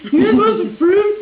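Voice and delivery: high, loud, pushy repeat; tipsy offer. Retold or imitated. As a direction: imitate a drunk guest pressing everyone. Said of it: "'Cause I was, like, wasted".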